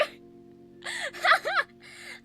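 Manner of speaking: squealing embarrassed giggle; shrill flustered laugh; high squealing laugh. Real, not acted.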